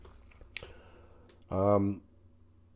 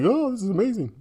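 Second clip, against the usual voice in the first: higher, softer mock gushing; put-on wonder, pitched up and light; mock amazement; 7 semitones higher, 6 dB quieter than usual. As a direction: imitate someone swooning over good news. Imitating people delighted with the market.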